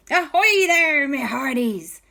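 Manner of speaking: high, loud pirate greeting; ahoy like a jolly pirate; pirate cat voice. Acted. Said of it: "And Kwazii said".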